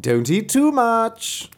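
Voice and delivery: high, loud, slow sing-out; drawn-out high fox call; fox warning. Acted. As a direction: call the warning out, stretched slowly. Fox's warning to the gorging wolf.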